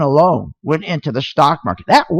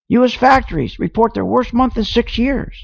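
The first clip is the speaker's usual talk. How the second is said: ordinary talk, nothing marked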